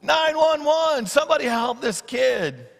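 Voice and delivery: high, startled call for help; alarmed cry. Pretend. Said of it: "you would be like".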